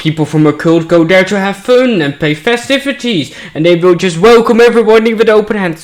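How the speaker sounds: loud, high mocking sarcasm; sarcastic. Real, not acted. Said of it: "Sorry for my sarcasm".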